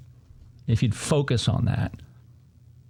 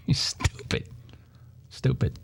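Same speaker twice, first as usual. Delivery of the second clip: whispered insult; 6 dB quieter than usual. Real, not acted. Muttered insult after a laugh.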